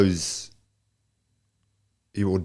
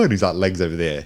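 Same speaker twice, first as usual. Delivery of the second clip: ordinary delivery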